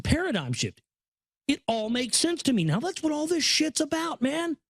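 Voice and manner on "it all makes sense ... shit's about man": excited realisation. Real, not acted. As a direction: voice jumps much higher as it all clicks. Sudden realisation while reading.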